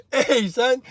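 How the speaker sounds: words through leftover laughter; real laughter in the voice; laughing. Real, not acted. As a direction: still giggling as you talk. Still laughing at own joke.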